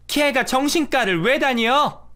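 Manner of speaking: disbelieving mother-in-law demanding why; high, slow incredulous question. Acted.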